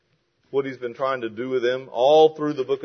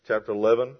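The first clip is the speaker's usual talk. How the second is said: ordinary delivery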